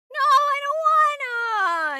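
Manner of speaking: put-on very high, slow child's whine; a small child refusing to die; whining child. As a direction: beg like a scared little kid. Doomed child refuses tearfully.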